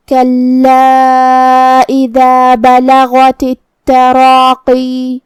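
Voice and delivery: slow chant, stopping on التراقی with long ی; Quran recitation with pause. Shown as a demonstration. Teacher shows stopping at التراقی, slow chant. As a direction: recite and hold the final ی of التراقی. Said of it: "التراقی پہ رکیں گے تو آخری میں مد یا ظاہر کریں گے".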